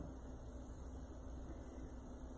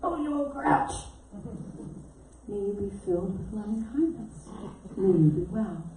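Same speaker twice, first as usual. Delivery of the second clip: grumbling aside; 3 semitones lower, 7 dB quieter than usual. Retold or imitated. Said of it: "the old consciousness would percolate up".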